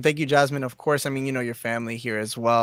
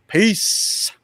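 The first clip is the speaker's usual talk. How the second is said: upbeat sign-off; 7 semitones higher, 6 dB louder than usual. Real, not acted. Signing off the stream.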